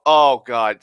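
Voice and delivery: usual speaking voice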